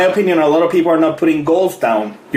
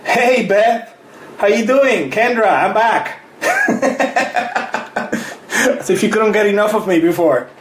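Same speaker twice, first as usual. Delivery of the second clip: chuckling as you welcome her back; laughing greeting; 6 semitones higher than usual. Real, not acted. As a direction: laugh through it, higher and amused. Greets returning viewer, laughing.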